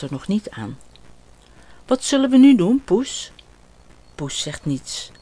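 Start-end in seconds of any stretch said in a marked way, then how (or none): 1.9-3.3 put-on higher, louder child asking his pet; a bored boy looking for a game; little boy voice. Ot asking his cat what to do, eager child voice.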